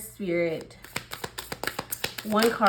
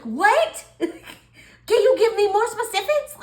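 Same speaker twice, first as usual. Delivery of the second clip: laughing disbelief; 13 semitones higher, 6 dB louder, slower than usual. Pretend. Laughing while acting confused Taurus.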